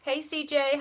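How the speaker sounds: ordinary talk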